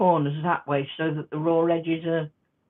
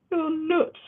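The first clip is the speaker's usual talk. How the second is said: much higher, amused imitation; high mock disbelief; 12 semitones higher than usual. Club members reacting to her hand stitching, high-pitched imitation. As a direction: repeat it in a high mocking pitch. Repeats club's reaction after laughing.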